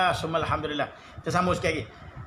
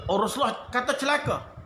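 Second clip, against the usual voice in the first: usual speaking voice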